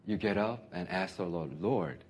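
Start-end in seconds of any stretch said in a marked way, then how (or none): 1.6-2.0 quiet prayer. Pretend. Voicing a humble prayer.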